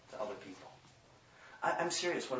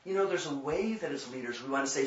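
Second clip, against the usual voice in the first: ordinary delivery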